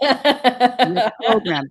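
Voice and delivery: high, drawn-out call; yelling. Real, not acted. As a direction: yell it slowly, stretching each word. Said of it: "I don't know why I'm yelling".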